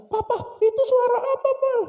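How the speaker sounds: put-on very high childish voice; little child. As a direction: ask like a small kid. Small child asking. (suara anak kecil tinggi)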